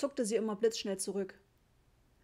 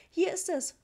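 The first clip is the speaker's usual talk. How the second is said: high, louder call; 8 semitones higher, 5 dB louder than usual. A narrator's reading. Mother pulling the door wide for the repairman, calling out. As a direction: call it out urgently. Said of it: "rief Mama".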